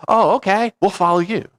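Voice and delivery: high-pitched, put-on easy agreement; mock gullible followers. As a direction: play a crowd readily going along. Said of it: "everybody, everybody goes".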